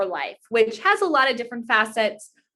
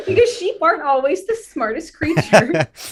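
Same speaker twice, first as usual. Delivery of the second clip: laughing; 7 semitones higher, 3 dB louder than usual. Real, not acted. Joking that sheep aren't smart.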